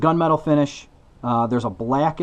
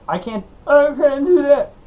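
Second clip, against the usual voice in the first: much higher, loud frantic comic voice; Bobcat Goldthwait impression; 12 semitones higher, 5 dB louder than usual. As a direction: whine it like a flustered stand-up. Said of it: "And then he says".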